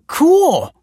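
very high, loud exclamation; thrilled. A narrator's reading. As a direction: squeal with teenage delight. Excited teen hears the news.

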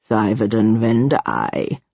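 low, slow formal address; imperious ruler. A narrator's reading. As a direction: pronounce the name gravely and deliberately. Ruler addresses her by name.

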